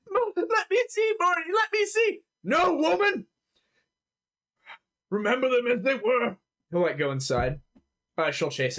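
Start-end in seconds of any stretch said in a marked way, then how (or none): 0.1-2.2 shrieky high-pitched pleading; crying wife. Acted. Wife begs husband to see the bodies, high tearful plea. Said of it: "The wife is just crying". 2.4-6.4 raised, high strained refusal; stern husband. Acted. Husband forbids wife to see dead children, strained insistence.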